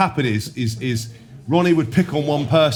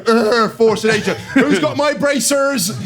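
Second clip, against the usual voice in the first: slow, higher, louder drawl of "force of nature"; mocking teammate drone; 10 semitones higher, 4 dB louder, slower than usual. Teammate taunting him on the flight, slow higher drone. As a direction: stretch it out like a lazy taunt. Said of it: "you just go".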